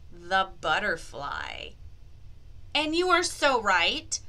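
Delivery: normal talking voice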